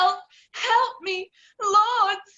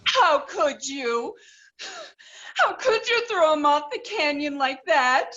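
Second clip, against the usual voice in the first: tearful damsel. Acted. Damsel grieving dead hero.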